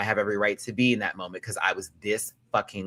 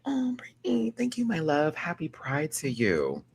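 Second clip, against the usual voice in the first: tender; 8 semitones higher than usual. Real, not acted. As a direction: thank her tenderly. Tender thanks to viewer.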